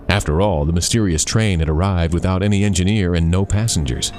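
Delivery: ordinary delivery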